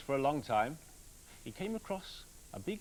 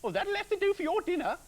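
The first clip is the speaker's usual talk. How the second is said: very high, loud nagging wife; grumbling wife; 6 dB louder than usual. Acted. Busy wife grumbles at her husband coming home, high and loud. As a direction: grumble at him in a shrill housewife voice. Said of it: "she said".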